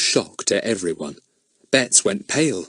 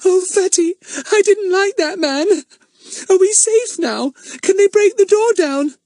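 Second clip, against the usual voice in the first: put-on crying girl; 6 dB louder than usual. A narrator's reading. Frightened Betts asks Fatty if they are safe, tearful and high. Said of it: "Betts was crying".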